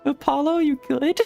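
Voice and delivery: usual speaking voice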